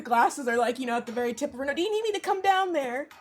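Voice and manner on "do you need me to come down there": put-on high old-woman voice, offering help; little old lady. Old lady calling down to the guard, high voice. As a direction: ask from above as an old lady. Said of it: "you hear a little old lady".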